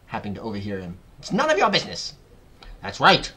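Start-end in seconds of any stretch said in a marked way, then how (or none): 1.2-2.1 high, loud angry snap; Aunt Spiker snapping. A narrator's reading. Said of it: "Aunt Spiker snapped". 2.8-3.3 loud, emphatic agreement; Aunt Sponge declaring. A narrator's reading. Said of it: "Aunt Sponge declared".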